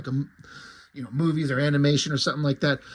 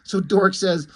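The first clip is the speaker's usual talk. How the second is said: usual speaking voice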